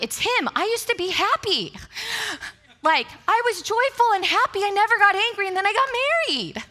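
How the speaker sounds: whiny blaming. Retold or imitated. Wife whining that marriage made her angry, high and hammed-up. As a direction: play the hard-done-by wife, laughing at yourself. Blaming husband, mock self-pity.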